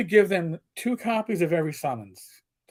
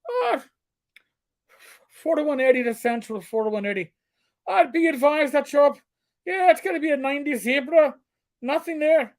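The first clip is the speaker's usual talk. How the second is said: stage-Irish cop calling Central, pitched higher; hammed-up Irish brogue over the police radio; playful Irish accent on the radio; 5 semitones higher than usual. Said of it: "go over as Sector Eddie and go".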